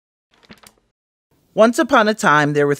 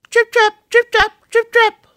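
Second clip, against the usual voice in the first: very high, light little steps sung out; tiny trip trap of the bridge; 5 dB louder than usual. A narrator's reading. Bridge under the smallest goat, high and dainty. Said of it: "went the bridge".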